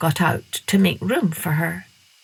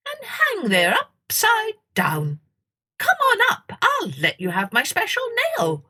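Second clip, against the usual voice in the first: squeaky bat; 4 semitones higher than usual. A narrator's reading. Bat showing Masha how it hangs upside down.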